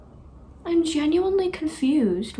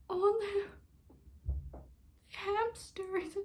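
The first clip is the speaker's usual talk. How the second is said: soft, high-pitched coo; melting; 8 semitones higher, 12 dB quieter, much slower than usual. Real, not acted. Overcome by cute hamsters on screen, melting. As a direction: squeak it softly, overcome by cuteness.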